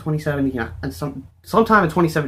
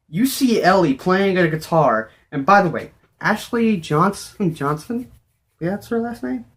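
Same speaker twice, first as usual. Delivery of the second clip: overexcited babbling; 7 semitones higher than usual. Real, not acted. Rushed excited rambling, high pitched.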